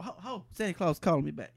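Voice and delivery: normal talking voice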